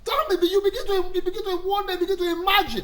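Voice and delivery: laughing disbelief. Acted. Mocking disbelief about children's looks.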